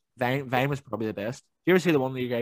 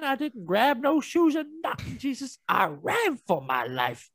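mock interviewee; 10 semitones higher, slower than usual. Continuing viral interview impression.

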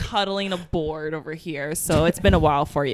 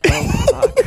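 shocked squeal, much higher; high squeak of dismay; 5 dB louder than usual. Retold or imitated. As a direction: squeak it out in panic. Retold reaction, squeaked.

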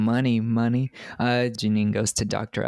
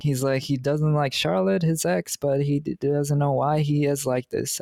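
ordinary talk, nothing marked

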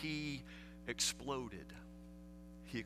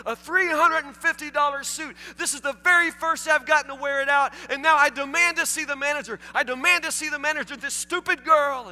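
much higher, louder outraged rant; hammed-up tantrum; 12 semitones higher, 10 dB louder than usual. The customer demanding the manager, hammed-up tantrum. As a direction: throw a full grown-up tantrum. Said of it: "his incredible tantrum".

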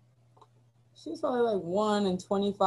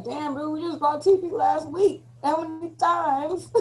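annoyed roommate; 8 semitones higher, slower than usual. Retold or imitated. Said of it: "you're like".

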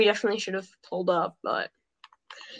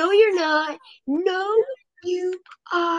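high-pitched denial; 9 semitones higher, slower than usual. Real, not acted.

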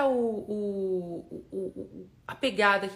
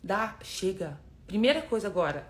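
ordinary talk

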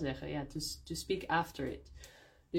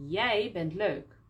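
slow, jij leaned on; stress on jij; 3 dB louder than usual. Shown as a demonstration. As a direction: land on jij, then let bent leuk fall away. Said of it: "dan gebruiken we jij". (nadruk op jij, langzaam)

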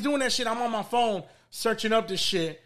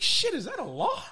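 much higher, startled squeak; retold surprise; 12 semitones higher than usual. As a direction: caught off guard, pitch jumps way up. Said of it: "I was like".

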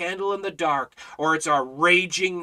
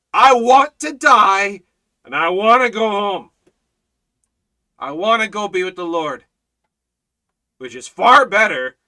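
Paul's longing; 3 semitones higher, 9 dB louder than usual. Retold or imitated. Preacher voicing Paul's wish to depart, loud. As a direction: wail it like Paul, half-joking. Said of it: "he says".

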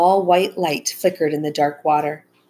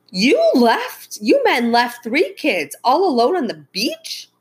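high, swooping outraged scolding; raised voice; 8 semitones higher, 3 dB louder than usual. A narrator's reading. Mom to her husband, raising her voice. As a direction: scold them in shocked indignation. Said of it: "she said, raising her voice".

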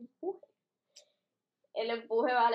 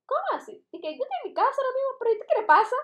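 high, mock-startled young woman; high puzzled girlfriend impression; surprised girl voice; 12 semitones higher, 4 dB louder, much faster than usual. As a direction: play the girl caught off guard. Girl's surprised reply voiced.

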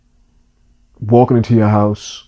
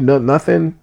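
normal talking voice

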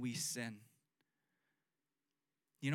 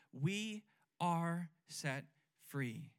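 slow emphasis; much slower than usual. Real, not acted. Slow proclaimed truth.

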